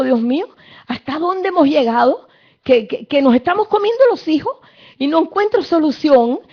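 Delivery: a king tearing his robes in anguish. Acted. Preacher voicing the despairing king crying to God. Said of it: "el rey se rasgó las vestiduras y dijo".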